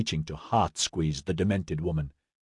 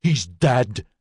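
anguished moan; a wounded, disbelieving wail, held in; 2 semitones higher than usual. A narrator's reading. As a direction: groan it in stunned grief. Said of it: "Maxon moaned".

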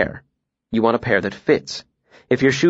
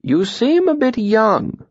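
slow, high-pitched hesitant doubt; 10 semitones higher, slower than usual. A narrator's reading. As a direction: draw it out as a hesitant mayor. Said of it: "he said hesitantly".

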